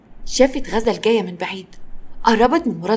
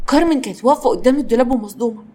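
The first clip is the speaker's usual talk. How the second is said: ordinary delivery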